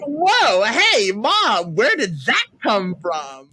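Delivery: high, loud, startled double take; playfully floored by her mom; mock surprise. Daughter reacting to mom's roleplay, high loud mock surprise.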